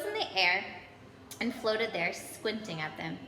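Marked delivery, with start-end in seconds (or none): none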